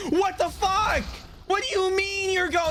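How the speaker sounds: shocked yelp at the screen; alarmed. Real, not acted. Streamer sees something alarming in game, shocked yell.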